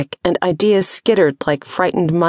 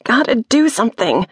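high urgent cry; 7 semitones higher than usual. Acted. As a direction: shout it against the noise. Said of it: "Wren's voice was lost in the noise".

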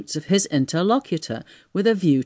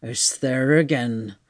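slow, low tramp's lament; tragic dismay; 4 semitones lower, slower than usual. Acted. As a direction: groan it out as if doomed. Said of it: "with a tragic gesture".

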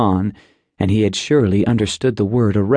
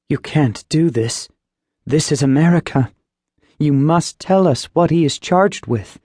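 lightly voiced mother, higher, insistent; protesting mother; 5 semitones higher than usual. Mother confronts the agent over her husband's arrest, higher and firm. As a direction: plead with the agent to give the charge.

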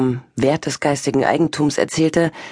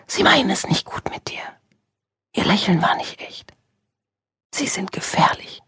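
much higher, urgent whisper; 5 semitones higher than usual. Acted. Stranger inside Julia warns her of danger, high whisper. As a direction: warn in a high, breathy whisper. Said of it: "Eine Stimme, über die ich keine Kontrolle besaß".

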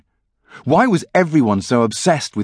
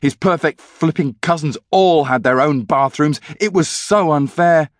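ordinary delivery, nothing marked

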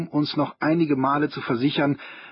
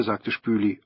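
usual speaking voice

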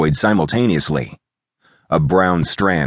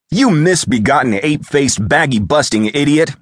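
high-pitched indignant shout; irate, raised-pitch outburst; angry shout; 10 semitones higher, 3 dB louder than usual. A narrator's reading. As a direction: hurl the insult at an untimely caller. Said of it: "I shouted at my untimely caller".

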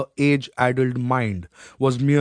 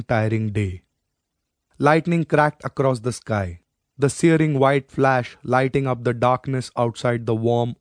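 ordinary delivery

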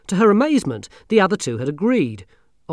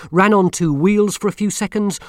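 plain reading voice

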